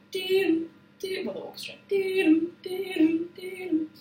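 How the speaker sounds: high sung doom for each piano note. Retold or imitated. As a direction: mimic the orchestra with high doom. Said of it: "the piano bit".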